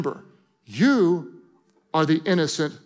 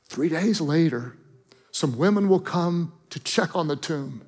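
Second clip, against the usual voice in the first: tender and hushed; 2 semitones lower than usual. Acted. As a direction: speak like a father breaking hard news gently. Tender God speaking to Jesus.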